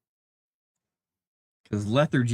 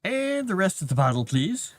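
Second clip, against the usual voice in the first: higher, pleading little cat voice; cat's imagined voice; 8 semitones higher than usual. Pretend. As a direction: voice the cat begging for more. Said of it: "Now he's like".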